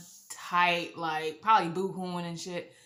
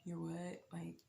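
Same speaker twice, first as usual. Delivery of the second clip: very soft, barely voiced question; stunned hush; 2 semitones lower, 15 dB quieter than usual. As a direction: say it under your breath, floored. Stunned reaction to pregnancy news.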